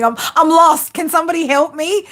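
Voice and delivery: high pleading mock damsel voice; playful helpless damsel plea; damsel in distress. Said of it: "I'm a damsel in distress".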